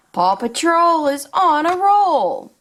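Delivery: put-on upbeat, sing-song storytelling for little kids; toy play narration. Showing toys to young viewers, upbeat and sing-song. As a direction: play along like a kids' toy show host.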